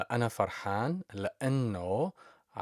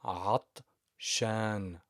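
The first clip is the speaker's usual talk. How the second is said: slow model word; much slower than usual. Shown as a demonstration. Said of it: "slow repeated model word".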